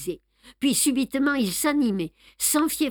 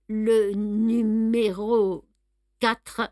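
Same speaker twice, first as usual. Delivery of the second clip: slow, faltering, each word dragged out; a dying man forcing out the words; barely able to get it out, slowly; hesitant; much slower than usual. A narrator's reading. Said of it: "Sa voix hésita".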